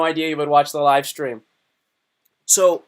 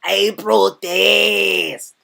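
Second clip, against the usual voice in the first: very slow, drawn-out, louder put-on voice; Bruce Lee film quote; 3 semitones higher, 6 dB louder, much slower than usual. Asking the chat if they know the reference, slow put-on voice. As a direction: stretch it out like the movie line. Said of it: "that reference where I go".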